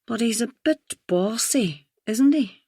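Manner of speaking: put-on slow posh accent, rising high at the end; plummy upper-class voice, teasing question. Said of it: "she had put on a posh voice".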